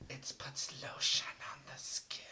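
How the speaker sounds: slow, creepy whispered villain line. Retold or imitated. Cohost joking about the creep, in a creepy whisper. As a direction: whisper it slowly like a basement killer. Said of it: "He's, like, gonna be like".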